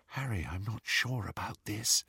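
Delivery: hushed, anxious near-whisper; breathy whisper. Acted. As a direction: breathe it out softly, worried. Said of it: "breathed Hermione".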